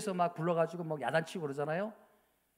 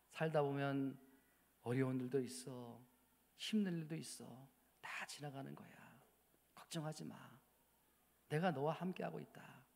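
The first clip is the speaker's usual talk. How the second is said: much softer, low, gentle reassurance; tender voice of God; 6 semitones lower, 7 dB quieter than usual. Acted. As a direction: comfort them like a loving father. Tender soft voicing of God.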